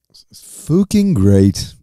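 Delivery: slow, hammed-up English accent. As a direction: drag it out in a mock English accent. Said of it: "It's my English accent".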